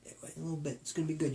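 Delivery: ordinary talk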